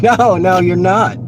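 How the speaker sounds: mock scolding shout. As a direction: call them off, half laughing. Retold shout at pedestrians.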